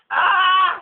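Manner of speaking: high, loud cry of pain; pained yelp. Real, not acted. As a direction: yelp as the shot lands. Shot at point blank.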